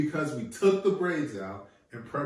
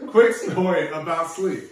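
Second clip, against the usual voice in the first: loud interruption; 5 semitones higher, 11 dB louder than usual. Real, not acted. Cutting in over other speaker.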